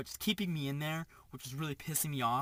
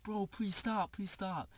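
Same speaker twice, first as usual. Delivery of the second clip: higher-pitched imitation of begging; pleading quote, pitched up; retold frightened plea; 5 semitones higher, slower than usual. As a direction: beg him off, mock-scared. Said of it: "I'm, like, shivering".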